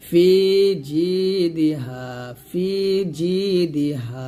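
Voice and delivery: ফি, জি and হা held long, দি short; elongated vowels. Shown as a demonstration. Teacher shows the madd, ফি and জি drawn out. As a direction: stretch ফি and জি, keep দি brief. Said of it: "টান দিবেন".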